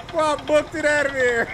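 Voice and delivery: high, loud, laughing holler; laughing shout. Real, not acted. Player just fled the enemy, laughing shout. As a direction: crack up while hollering.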